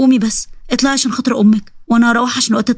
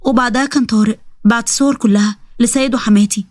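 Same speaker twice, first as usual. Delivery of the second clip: ordinary delivery, nothing marked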